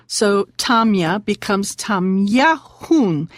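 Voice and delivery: Tamya, then Tamyahun slowly with the K said as H; slow teacher demo of Tamyahun; slow pronunciation demo. Shown as a demonstration. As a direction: spell it out slowly for learners. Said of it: "adding the letters K U N".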